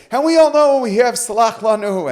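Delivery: high, loud declaiming on a held note; mock public proclamation. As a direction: imitate a speaker belting it out to the room. Imitating speaker addressing congregation.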